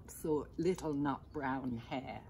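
ordinary delivery, nothing marked

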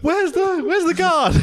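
high-pitched mimicked alarm; mock panic. Imitating onlookers at a gorilla fight, high panicky cry. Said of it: "they're like".

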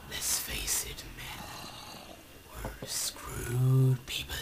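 whispered like a doomed captain to his men; mock whisper. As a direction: breathe it out in defeat. Rallying his imaginary men.

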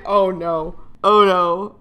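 loud alarmed cry of dismay; panicked loud oh no. Real, not acted. Gamer panicking as the flight goes wrong, loud alarm. Plane trouble in game.

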